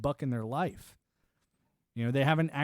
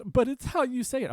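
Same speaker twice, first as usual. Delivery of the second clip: mocking whiny critic; 11 semitones higher, 4 dB louder than usual.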